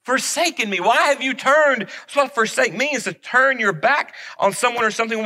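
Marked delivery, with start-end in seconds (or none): 0.0-1.8 higher and louder anguished cry from the cross; Jesus's cry. Retold or imitated. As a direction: cry out to God as one forsaken. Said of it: "And he says".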